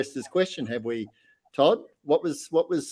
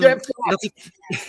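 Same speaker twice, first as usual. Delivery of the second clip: gleeful echo; 9 semitones higher, 4 dB louder than usual. Acted. Echoing the punchline gleefully.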